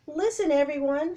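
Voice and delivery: loud high call to the beach; shouting to crowd. Acted. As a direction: grab the crowd's attention. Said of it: "shouted Annie".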